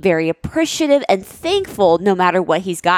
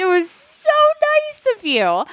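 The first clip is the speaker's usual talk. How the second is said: higher, put-on thankful voice; hammed-up thank you; fake gratitude; 8 semitones higher than usual. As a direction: overplay being touched by the gift. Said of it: "I could have won an academy that day".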